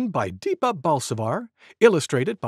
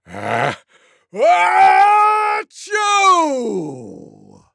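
tiger's huge sneeze; 12 semitones higher, 8 dB louder, much slower than usual. A narrator's reading. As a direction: let out an enormous drawn-out sneeze. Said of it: "gave the loudest sneeze you could".

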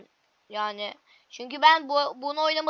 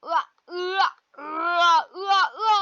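playful fake "ua ua" wail, a bit higher; mock wailing; 3 semitones higher, 4 dB louder, slower than usual. Gamer to viewers after failing a jump, mock wail. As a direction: whine like a kid losing a round. Falls in game, wails.

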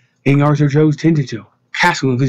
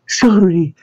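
higher, playful sorry; teasing, higher-pitched apology; grinning apology; 4 semitones higher than usual. A narrator's reading. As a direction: say it through a grin, not very sorry. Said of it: "grinned back Lorna".

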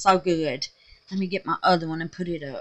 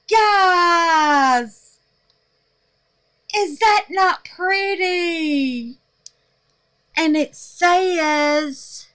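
much higher, louder and slow; overjoyed; 8 semitones higher, 6 dB louder, much slower than usual. Real, not acted. Admiring her first bar of soap, overjoyed. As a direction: gush over it in awe.

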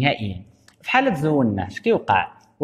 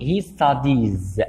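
he studies drawn out very slowly; slow model sentence; much slower than usual. Shown as a demonstration. Teacher models the ies ending slowly. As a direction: spell out studies slowly for the class. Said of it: "وكتعمل اي اي اس".

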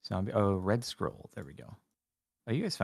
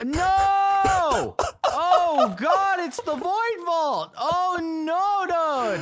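high panicked cry; 6 dB louder, much slower than usual. Real, not acted. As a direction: cry out in alarm. Walks into feared vault.

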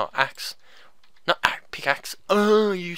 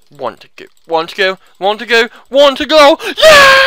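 escalating challenge; 7 semitones higher, 10 dB louder than usual. Pretend. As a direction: build each repeat louder and higher. Challenging the last enemy.

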